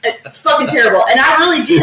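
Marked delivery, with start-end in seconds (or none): none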